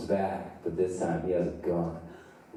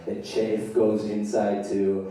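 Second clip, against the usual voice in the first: ordinary talk, nothing marked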